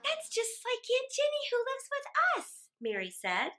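put-on high, bright child's voice; little girl. A narrator's reading. Mary tells her father about Aunt Jenny, high and eager. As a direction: chatter on like a small girl.

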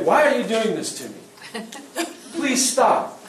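a pleading, rising plea to an attacker, shown as the wrong way; mock pleading. Shown as a demonstration. Instructor shows the weak plea to an attacker: mock pleading. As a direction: beg them to stop, lightly acted. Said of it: "you don't wanna say".

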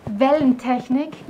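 ordinary talk, nothing marked

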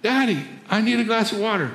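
put-on little boy. Boy asking father for water again, light child voice.

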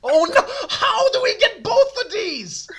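high squealing laugh-cry of panic; laughing in real panic as the bomb module appears; panicked laughing. Real, not acted. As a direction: shriek it through laughter.

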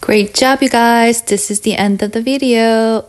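loud, bright cheer to close the video; excited sign-off. Real, not acted. Teacher cheering her viewers at the end of the video. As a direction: praise them with big energy.

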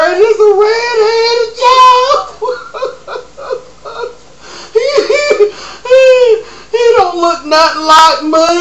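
high, loud hammed-up sobbing confession; mock sobbing. Guest sobbing to Maury about her boyfriend. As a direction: blubber it out in a wail. Guest explains on talk show.

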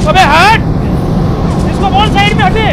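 high, urgent gamer shout; urgent excited call. Real, not acted. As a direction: panicky orders in a fight. Knocked, urgent commands. (घबराई हुई तेज़ आवाज़)

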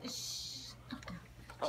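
hushed whisper, slow. Real, not acted. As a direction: shush her softly, slowly. Mother hushing her groggy child.

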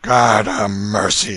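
slow, loud dismayed cry; shocked exclamation. A narrator's reading. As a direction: gasp it out in dismay. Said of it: "exclaimed Canty".

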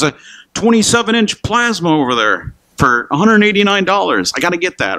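high-pitched bargain-hunter excitement; mock eager shopper. Preacher mocking Christmas stampeders, eager shopper voice. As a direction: play a shopper hungry for a deal.

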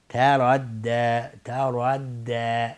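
very slow تردی, only the r thick; تردی ending on a thin, open d; light, heavy, light. Shown as a demonstration.

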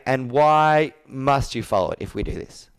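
normal talking voice

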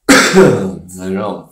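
ordinary delivery

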